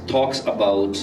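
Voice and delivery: ordinary talk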